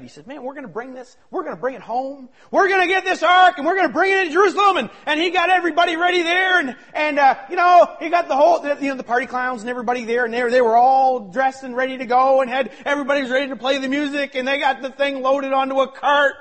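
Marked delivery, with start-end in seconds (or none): 0.3-4.8 excited. Retold or imitated. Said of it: "he get all excited". 4.8-16.3 loud, high, driving sermon; full-force preaching. Acted.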